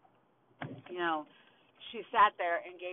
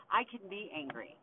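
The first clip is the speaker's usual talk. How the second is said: ordinary talk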